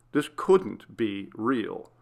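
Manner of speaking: usual speaking voice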